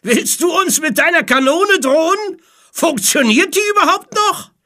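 high, loud mocking questions; shouted taunt. A narrator's reading. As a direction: jeer across at the pirate. Mocking reply shouted from other ship. (laut und spöttisch hinübergerufen)

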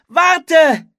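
loud, very high shout to halt; scream. A narrator's reading. Anne stops Philipp climbing the ladder, screaming. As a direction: scream out to stop someone leaving. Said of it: "schrie Anne".

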